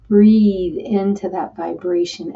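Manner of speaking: ordinary talk, nothing marked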